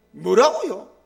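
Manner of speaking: loud, indignant retort to God; indignant outburst. Retold or imitated. Pastor protesting to God, loud and indignant. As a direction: burst out in disbelief at God. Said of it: "우락통이 터지는 거예요".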